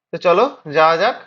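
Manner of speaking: normal talking voice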